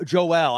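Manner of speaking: "Joel" split in two, Jo-EL; mispronounced name. Retold or imitated. Guest lists wrong names he gets called, mispronounced. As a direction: say "Joel" as two syllables, like Joe-L. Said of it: "I've been called Joe Robinson".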